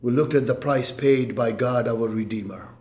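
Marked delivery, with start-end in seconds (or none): 1.9-2.7 hushed refrain. Acted.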